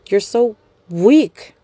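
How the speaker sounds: drawn-out scornful sneer; slow sneer. Acted. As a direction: sneer it out slowly. Young woman sneering at captive.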